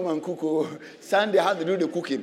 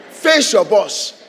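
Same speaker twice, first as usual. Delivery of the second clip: slow, louder, punched command; forceful command; 8 dB louder, much slower than usual. Real, not acted. Preacher urges believers to face their boss, forceful. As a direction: hammer the command home. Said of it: "preacher exhorting".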